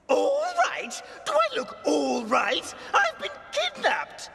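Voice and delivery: outraged, as if the question is absurd; indignant outburst. Acted.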